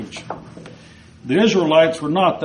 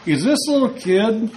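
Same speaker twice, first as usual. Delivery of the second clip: scornful Goliath; 5 semitones higher than usual. Retold or imitated. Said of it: "He's like".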